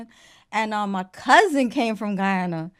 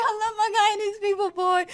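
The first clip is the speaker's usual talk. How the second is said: put-on higher Guyanese "I love my Guyanese people, boy"; Guyanese accent; 11 semitones higher than usual. Joining the TikTok fight, playful Guyanese accent. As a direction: gush in Guyanese. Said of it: "I was like".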